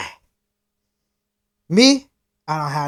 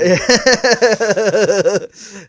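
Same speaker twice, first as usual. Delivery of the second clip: laughter; 5 semitones higher, 4 dB louder than usual. Real, not acted. Laughing after the impression.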